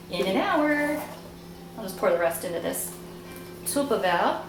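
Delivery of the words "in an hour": a slow, stretched, laughing German accent on hour; slipping into a playful German accent by accident; a touch of a German accent; mock German accent. Real, not acted. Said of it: "I don't know why I went German there".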